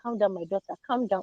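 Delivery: mother quietly soothing her daughter, calm down. Retold or imitated. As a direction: gently hush an upset daughter. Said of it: "She was saying".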